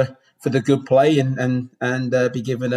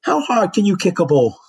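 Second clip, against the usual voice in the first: higher, lightly voiced chairman's question; Theo imitated; 8 semitones higher, 4 dB louder than usual. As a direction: mimic the chairman sizing you up, pitched up. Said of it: "and then he goes".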